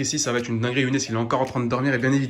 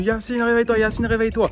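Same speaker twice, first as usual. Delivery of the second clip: wake-up call; 7 semitones higher than usual. Retold or imitated. Brother's wake-up call retold.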